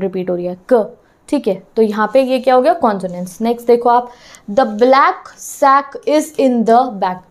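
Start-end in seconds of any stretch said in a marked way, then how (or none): 4.5-7.2 every word stretched out very slowly; teacher reading a sentence very slowly for the class. Shown as a demonstration. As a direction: read it slowly so the repeated k sound stands out. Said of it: "again and again के sound is being repeated".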